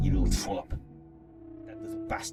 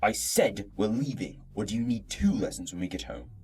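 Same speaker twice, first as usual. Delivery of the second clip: much lower, cold commanding voice; stern father's order; 13 semitones lower, 3 dB quieter than usual. Acted. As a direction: order the boy out, no arguing. Father threatens Joseph.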